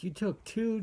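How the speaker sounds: high rising disbelieving echo; can't believe she took two; shocked question, pitch jumping up on two; surprised. Retold or imitated. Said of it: "And I'm like".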